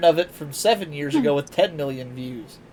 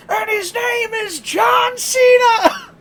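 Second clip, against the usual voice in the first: much higher, louder, drawn-out announcer yell; John Cena intro shout; 6 dB louder, much slower than usual. Retold or imitated. Retelling the prank call punchline, as a ring announcer. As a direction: belt the name like a ring announcer.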